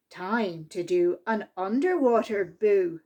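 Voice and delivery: ordinary delivery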